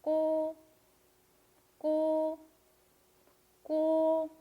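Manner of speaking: 꼬 held high and level; high, long tone. Shown as a demonstration. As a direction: stretch 꼬 on one steady high note. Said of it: "높고 길게 읽어보도록 하겠습니다".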